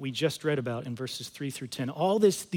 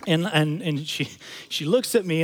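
ordinary talk

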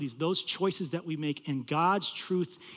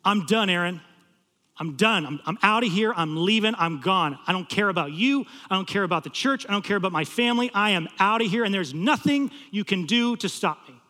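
loud, insistent mock rant; retold angry yelling; 3 semitones higher, 5 dB louder than usual. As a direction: act out a student's outburst, louder and piling up. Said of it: "he just starts screaming and yelling at me".

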